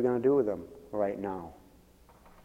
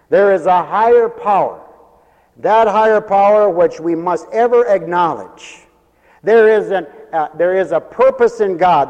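loud, high, ringing declaration; raised pulpit voice, hammering key words; full-force preaching; 7 semitones higher, 11 dB louder than usual. Real, not acted. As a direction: preach it out forcefully to the whole congregation.

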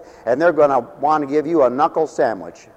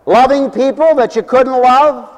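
preaching; 10 semitones higher, 7 dB louder than usual. Acted. Preacher rising.

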